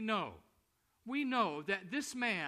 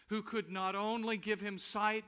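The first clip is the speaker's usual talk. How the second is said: ordinary delivery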